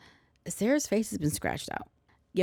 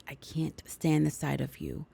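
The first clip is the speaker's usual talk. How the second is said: hissed whisper, lower and softer; menacing whisper; 4 semitones lower, 6 dB quieter than usual. Retold or imitated. Grandmother gripping Sarah's arm, menacing whisper. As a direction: whisper it like a threat.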